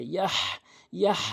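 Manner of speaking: ইয়াহ ending in a strong throaty h; pressed ha. Shown as a demonstration. Teacher drilling the big ha, pressed h ending ইয়াহ. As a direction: push the final h of ইয়াহ from mid-throat. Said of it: "চাপ দিয়ে বলতে হবে".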